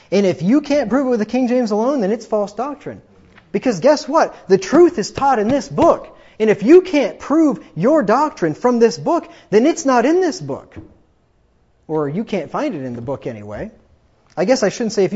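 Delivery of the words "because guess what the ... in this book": rising preaching. Real, not acted. Preacher drives home main point.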